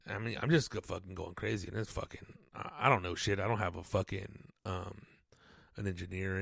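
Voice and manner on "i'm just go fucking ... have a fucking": usual speaking voice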